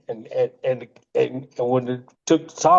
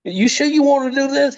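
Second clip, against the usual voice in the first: pitched way up, anxious and pleading; hammed-up worried question, much higher; mimicking a nervous Pat; 12 semitones higher than usual. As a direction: ask it like you're sure this will end badly. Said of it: "He kept, just kept asking me".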